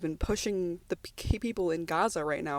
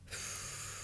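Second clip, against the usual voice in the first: shaky tearful sigh; 16 dB quieter than usual. Real, not acted. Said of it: "I'm getting a little teary".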